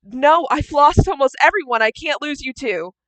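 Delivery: high, loud anguished protest; screamed plea. Acted. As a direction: cry out in character, begging not to lose him. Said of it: "And Spring is screaming".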